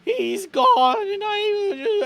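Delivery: high, slow wail breaking into sobs; crying. Acted. Chet confesses why he made the skull, crying hard. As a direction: act a full-on tearful breakdown, pitch climbing. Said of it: "just full on just rambling, just crying".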